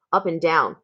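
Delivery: usual speaking voice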